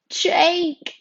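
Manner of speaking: high, loud cry of his name; about to bawl. A narrator's reading. Girl calling her boyfriend for help, near tears. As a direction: call out high, on the edge of bawling. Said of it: "before I start bawling".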